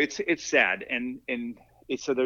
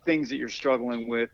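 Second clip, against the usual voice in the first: normal talking voice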